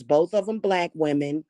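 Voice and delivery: normal talking voice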